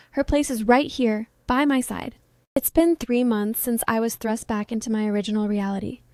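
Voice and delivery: plain reading voice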